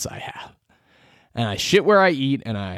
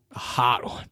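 a whispered, longing repeat; whispered craving; 7 dB quieter than usual. Real, not acted. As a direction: breathe the words like a secret craving.

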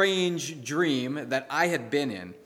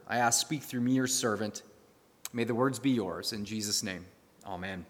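lower, measured praying voice; prayer; 3 semitones lower than usual. Real, not acted. Leads the congregation in prayer.

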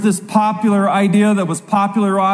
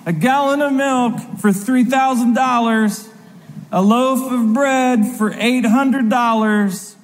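someone yelling out; 5 semitones higher than usual. Acted. Seller announcing inflated prices, mock yelling out. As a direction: call out each price like a street seller. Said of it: "like someone yelling out".

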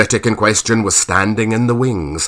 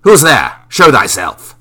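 louder commanding challenge; challenging shout; 3 semitones higher, 6 dB louder than usual. A narrator's reading. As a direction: demand he show himself, hand on sword. Marlowe challenging, hand on sword.

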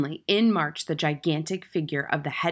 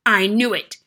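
loud, raised-pitch outburst; furious bellow; 4 semitones higher, 10 dB louder than usual. A narrator's reading. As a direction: bellow it in outrage. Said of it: "she bellowed".

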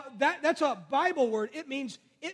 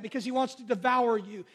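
ordinary talk

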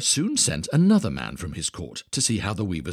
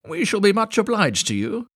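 fawning thieves; 13 semitones higher, 6 dB louder than usual. Acted. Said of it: "said the thieves".

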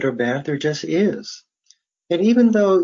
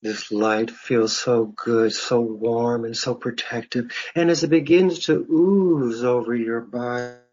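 guided meditation; 3 semitones lower than usual. Real, not acted. Faculty member leading listeners through breathing and imagery, guided meditation. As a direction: guide them into relaxation, phrase by unhurried phrase.

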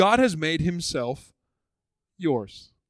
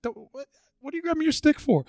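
surprised God; 10 semitones higher, faster than usual. Retold or imitated. God questioning Moses.

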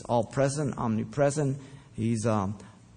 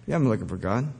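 usual speaking voice

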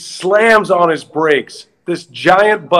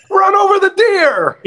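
high put-on boss voice giving a blunt order; deadpan the punchline in character; mock manager order.

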